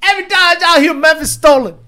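high, loud exclaimed read. Real, not acted. Streamer reading a chat message aloud with a loud exclaim.

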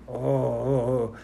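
mock groaning of a carsick drunk; imitated sick moaning. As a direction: groan like you're about to throw up.

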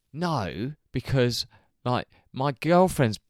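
loud, insistent mock excuse; mock protest. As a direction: imitate a drunk man defending himself. Quoting drunk man's defence.

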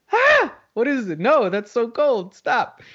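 high-pitched, hammed-up shock; mock frightened yelp. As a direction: act out being startled by ice-cold water. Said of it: "It's frightful feeling the very first time".

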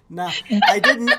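loud laughing denial. Real, not acted. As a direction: laugh while protesting.

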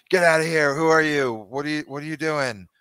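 high, loud angry yell; a frightened father threatening with a pitchfork; angry farmer shout. Acted. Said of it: "he grabs a pitchfork and".

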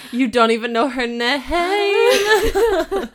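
high, drawn-out cry of disbelief; incredulous outburst. Real, not acted. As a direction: blurt it out, dissolving into laughter. Incredulous reaction to the story.

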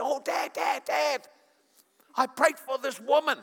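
put-on high, loud excited child voice; bubbling over like a thrilled nine-year-old; a girl rushing to tell her dad; excited daughter.

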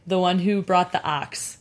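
ordinary delivery

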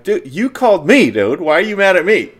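answering the angry scammer, in mock innocence; mock innocent. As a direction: play dumb, acting wronged. His innocent retort to scammer.

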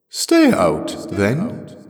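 high, loud, echoing dismissal; otherworldly voice. A narrator's reading. The voice lets Jirel stay out, ringing and otherworldly. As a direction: ring it out from inside the globe.

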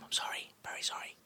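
a hushed, breathy little sorry; whispered apology. Real, not acted. Whispering a sheepish sorry to the co-host for a possible mistake. Joking apology for a wrong claim.

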